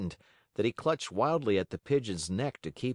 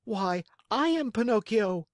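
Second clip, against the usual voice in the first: put-on high, eager child voice; eager little marionette; excited puppet boy; 12 semitones higher, 4 dB louder than usual. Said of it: "replied the Marionette".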